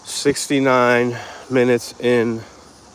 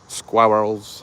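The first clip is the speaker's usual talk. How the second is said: put-on New York accent. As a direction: try "Squirrels" in a drawn-out New York accent.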